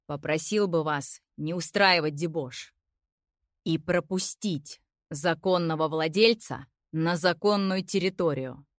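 low, soothing bass voice; calm deep bass. Acted. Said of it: "умиротворяющего ... баса".

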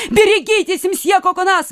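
very high, urgent warning cry; exclaiming. A narrator's reading. Lady warns Coconnas of danger above, exclaiming urgently. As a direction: cry a warning, pitched right up. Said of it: "воскликнула дама".

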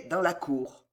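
plain reading voice

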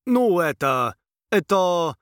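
hesitant prince, loud and unsure; stammering prince. A narrator's reading. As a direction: stumble over the words. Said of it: "вновь стал заикаться он".

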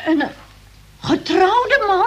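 scandalised. Acted. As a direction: gasp it out in moral outrage. Scandalised by the fur coat. (hoog en verontwaardigd geschokt)

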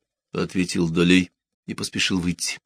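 usual speaking voice

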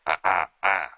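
short mic-test grunts, then a throat clear; self-important ahem into the mic. A narrator's reading. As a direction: clear your throat before a speech. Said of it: "đoạn e hèm".

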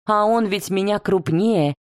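ordinary delivery

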